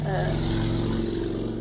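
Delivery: ordinary talk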